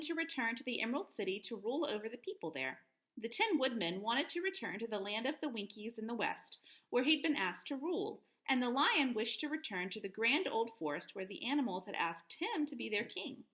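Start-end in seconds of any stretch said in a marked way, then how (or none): none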